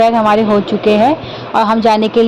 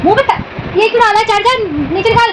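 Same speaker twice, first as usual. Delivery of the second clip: sharp high-pitched scolding, raised voice; 10 semitones higher than usual. Real, not acted. Mother scolding her little daughter over the charger, sharp and high.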